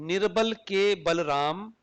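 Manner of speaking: slow निर्बल, के, Balram with के standing alone; measured reading, के held as its own word; deliberate word spacing. Shown as a demonstration. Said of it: "इसको तीन तरह से पढ़ सकते हैं".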